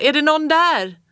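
loud high pleading question; shouting. A narrator's reading. Lost child shouts to anyone nearby, loud high plea. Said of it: "skrek jag".

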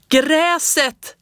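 high, loud excited yell; shouted. A narrator's reading. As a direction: burst out with it on sudden realization. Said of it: "skrek jag".

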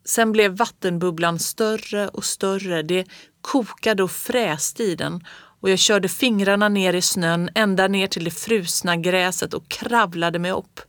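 usual speaking voice

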